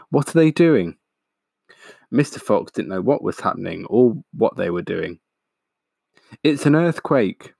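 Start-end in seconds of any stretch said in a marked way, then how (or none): 0.1-1.0 put-on high, anxious young fox's question; alarmed cry. A narrator's reading. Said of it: "cried the small foxes". 6.4-7.5 higher, mildly alarmed cry; cried out. A narrator's reading. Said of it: "cried Missus Fox".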